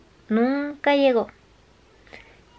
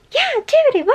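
lightly voiced teacher, pitched much higher and cheery; mock eager yes; 4 dB louder than usual. Teacher Ashley suddenly agreeing to go dancing, much higher, cheery. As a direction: chirp the agreement brightly.

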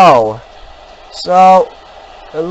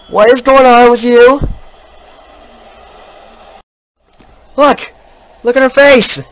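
higher, louder frightened calls; startled and panicking; alarmed cries; 7 semitones higher, 5 dB louder than usual. Real, not acted. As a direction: shout in alarm at the ghost. Alarmed at ghost.

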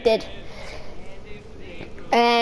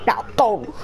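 put-on low, wavering old mentor voice; wise elder; 3 semitones lower, 5 dB quieter than usual. Elder Kettle advising the boys, aged mentor voice. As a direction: counsel them like an old-timer, breaking up in places.

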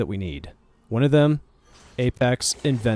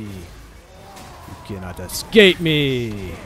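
sneering panto villain; 3 dB louder, slower than usual. Pretend. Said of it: "enough of that cringy bullshit".

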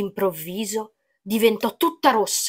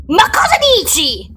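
high, raised-voice outburst; indignant; 8 semitones higher, 3 dB louder than usual. A narrator's reading. Witch insulted about her soup, indignant outburst. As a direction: burst out as an affronted witch. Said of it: "tuonò Cornabicorna indignata".

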